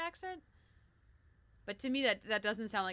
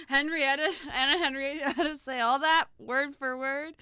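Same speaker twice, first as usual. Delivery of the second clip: loud, high, rising disbelief at the name; incredulous disbelief; 5 semitones higher, 10 dB louder than usual. Real, not acted. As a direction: repeat the name as if you can't believe it. Incredulous reaction to long signature.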